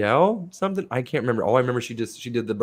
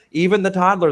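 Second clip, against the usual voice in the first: ordinary delivery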